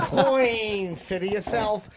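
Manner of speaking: mock English accent. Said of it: "bad English accent".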